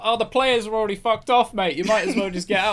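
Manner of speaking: high, loud offhand jeer; dismissive crew member. Retold or imitated. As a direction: wave him off as pointless. Crew voiced telling the tree actor.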